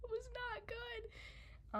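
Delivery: very high, soft and drawn out; high squeak. Real, not acted. Storyteller admitting how bad the injury was, squeaking. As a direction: squeeze it out slowly in a thin squeak.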